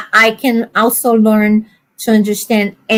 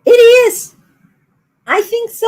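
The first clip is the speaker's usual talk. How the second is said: excited agreement; 11 semitones higher, faster than usual. Real, not acted. Agreeing about Scottish accent.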